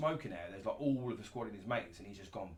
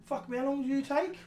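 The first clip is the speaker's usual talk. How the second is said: quoting G; 12 semitones higher than usual. Retold or imitated. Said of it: "on the bonnet of my Range Rover going".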